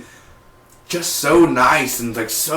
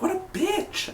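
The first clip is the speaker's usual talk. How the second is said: much higher, softer, catty scoff; mock disgust; 11 dB quieter, slower than usual. Fan mocking Sarah, high catty scoff. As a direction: sneer it in a high squeal. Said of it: "I was like".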